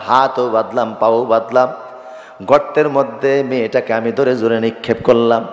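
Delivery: ordinary delivery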